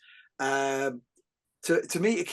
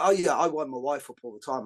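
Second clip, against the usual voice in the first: ordinary talk, nothing marked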